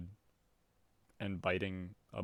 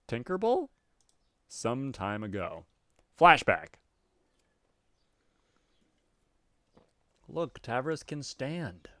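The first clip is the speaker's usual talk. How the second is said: lively, slightly louder narrator; story narration; 5 dB louder than usual. A narrator's reading. Reader narrating Tavros's game night, lively.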